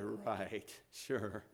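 soft, low sarcastic "sure" with a laugh; cynical scoff. Retold or imitated. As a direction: dismiss it with a quiet scoff.

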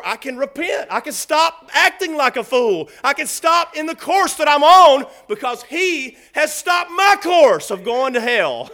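loud, driving confession from the pulpit; fervent preaching. Real, not acted. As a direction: build in urgent, full-voiced waves. Preaches with full force.

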